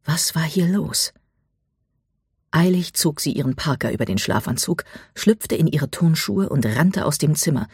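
ordinary delivery, nothing marked

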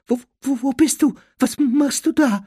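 high, stammering questions; frightened whimper. A narrator's reading. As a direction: call out fearfully in a high voice. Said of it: "wimmerte Dudley".

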